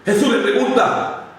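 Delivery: normal talking voice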